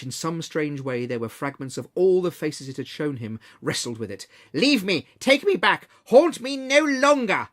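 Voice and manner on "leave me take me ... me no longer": high, loud frantic cries; frantic shouting. A narrator's reading. Scrooge wrestles the ghost, shouting frantically to be freed. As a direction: yell it while struggling.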